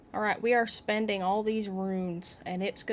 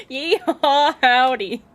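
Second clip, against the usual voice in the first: very loud, drawn-out southern yeehaw; higher, slower mock southern yell; hammed-up southern holler; 4 semitones higher, 11 dB louder, slower than usual. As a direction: stretch it into a big southern whoop. Repeats the southern cry, laughing.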